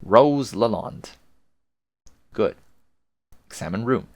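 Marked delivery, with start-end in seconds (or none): 0.0-1.1 drawn-out, louder naming; slow announcement. Pretend. Narrator naming the heroine for the player, slow and grand. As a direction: say the name slowly, with ceremony. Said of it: "One more time".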